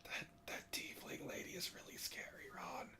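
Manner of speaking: very soft, barely audible whisper. Acted. Player whispering to Ron about the scary tiefling lady, true whisper. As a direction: whisper it so low it's lost. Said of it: "I couldn't hear you".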